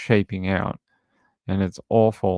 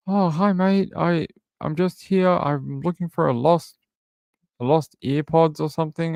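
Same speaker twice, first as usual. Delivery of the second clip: higher-pitched voice for a British visitor; imitated British guy; 7 semitones higher than usual. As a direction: play a British stranger on the intercom. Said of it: "it was some British guy".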